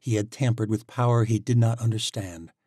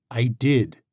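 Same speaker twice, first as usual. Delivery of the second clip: raised voice; 4 semitones higher, 7 dB louder than usual. Acted. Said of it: "Alec raised his voice".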